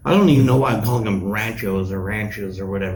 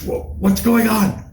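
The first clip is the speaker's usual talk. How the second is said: mom voice; 10 semitones higher than usual. Retold or imitated. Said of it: "Mom answers and goes".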